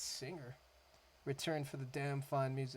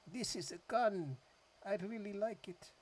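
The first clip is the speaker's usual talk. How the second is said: bad Russian accent; 6 semitones higher than usual. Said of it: "that was a bad accent".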